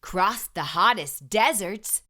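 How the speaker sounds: ordinary delivery, nothing marked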